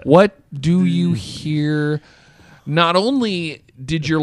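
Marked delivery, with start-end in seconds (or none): 0.0-2.0 very slow, stilted question stretching "do"; mock-stilted reading with a long "do"; stress on do. Shown as a demonstration. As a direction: draw out every word, leaning on "do". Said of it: "Emphasis on the do".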